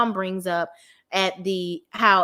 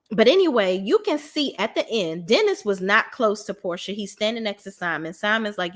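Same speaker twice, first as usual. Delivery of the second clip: ordinary delivery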